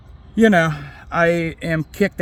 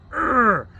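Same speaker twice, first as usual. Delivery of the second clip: frustrated groan; 10 semitones higher than usual. Real, not acted. Frustrated at himself.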